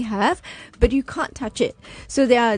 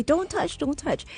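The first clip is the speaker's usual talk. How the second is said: anxious protest; 7 semitones higher, 3 dB quieter than usual. Retold or imitated. Voicing anxious teen warning his mom.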